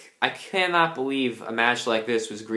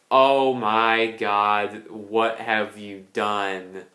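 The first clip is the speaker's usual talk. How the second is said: drawn-out, stunned disbelief; slow dismayed groan; 3 semitones lower, much slower than usual. Retold or imitated. Fan reliving a ruined finish, slow stunned disbelief. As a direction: stretch it out like watching a disaster. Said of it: "I was like".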